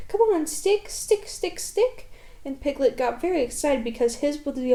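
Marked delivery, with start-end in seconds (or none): 0.1-2.1 high eager chant, climbing higher; little Roo calling out to his stick. A narrator's reading. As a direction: cheer the stick on like a wriggling child. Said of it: "calling out".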